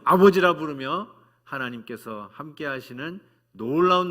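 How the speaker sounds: 힘차게 외치는 설교; preaching at full force. Real, not acted. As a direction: proclaim the blessing with fire. Preacher climax blessing.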